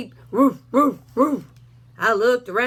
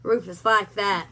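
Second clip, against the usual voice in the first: plain reading voice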